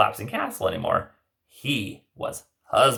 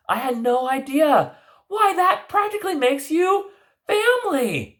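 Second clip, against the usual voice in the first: Cinderella voice; 4 dB louder than usual. Acted. Cinderella character voice.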